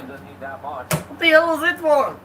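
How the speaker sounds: badly faked Russian accent, mock indignation; half-managed Russian accent for an affronted player; attempted Russian accent. Imitating Kaprizov after a shoulder to the face, attempted Russian accent. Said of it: "I can't do my Russian accent".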